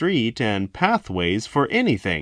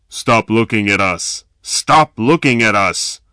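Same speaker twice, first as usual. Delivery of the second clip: loud snapping order; snapped; 6 dB louder than usual. A narrator's reading. Thin robber warning victim, loud snapped order. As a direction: snap it at him. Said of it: "His voice snapped".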